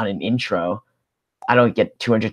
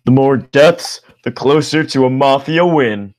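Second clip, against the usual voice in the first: Broomer voice; 5 semitones higher, 6 dB louder, slower than usual. Acted. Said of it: "And then Broomer".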